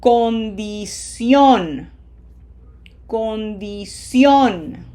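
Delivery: "Condición" slow, with bright Spanish i and closed, stressed final o; smiled I, closed O. Shown as a demonstration. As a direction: stretch "Condición", smiling on each i, pushing "-ción". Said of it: "smile for the eyes and closed O".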